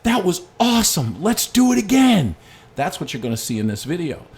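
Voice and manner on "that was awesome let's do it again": higher and louder, bursting with joy; excited child's yell, much higher and louder; thrilled kid. Retold or imitated. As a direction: shout it like a kid who just loved the ride. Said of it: "What do you think he said".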